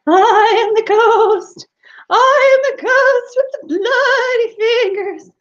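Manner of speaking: high, loud, slow ghostly wail. Acted. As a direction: let the ghost wail full out. Ghost wails louder.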